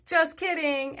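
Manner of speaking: high, loud prank reveal; Ashton Kutcher. Pretend. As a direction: jump out and announce the joke. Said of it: "Ashton Kutcher to come around the corner".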